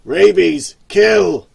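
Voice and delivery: loud, savage attack command; the boss orders his dog to attack. Acted. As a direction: set the dog loose. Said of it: "he ordered".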